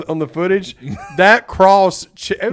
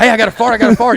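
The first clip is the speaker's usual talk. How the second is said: louder, higher eager call; excited kid; 5 semitones higher, 8 dB louder, faster than usual. Retold or imitated. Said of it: "and you'd be like".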